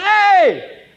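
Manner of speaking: high-pitched yell across a room. Acted. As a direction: call out from the back of the hall. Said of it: "yells out".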